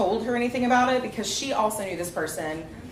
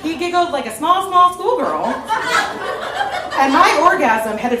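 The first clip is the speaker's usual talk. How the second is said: laughing while telling; 6 semitones higher, 6 dB louder, slower than usual. Real, not acted.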